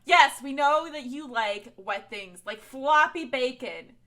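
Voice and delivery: loud, slow teasing through laughter; mock her with a big laugh; loud laughing tease. Real, not acted.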